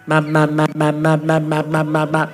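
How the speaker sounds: low steady 妈妈 chant repeated; demonstrating tongues as a toddler's 妈妈; baby babble tongues. Shown as a demonstration. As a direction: drone 妈妈 on and on like a baby. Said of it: "要么叫妈妈".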